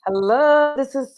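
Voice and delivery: real excitement, slow and lifted; excited welcome. Real, not acted. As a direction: welcome them with excitement, stretching the words. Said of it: "I am so excited".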